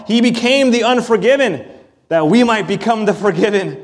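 ordinary talk

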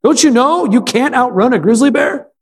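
high-pitched, alarmed questioning; anxious. Retold or imitated. Friend questioning the man putting on shoes, anxious. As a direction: question him in mild mock panic. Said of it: "his friend anxiously asked".

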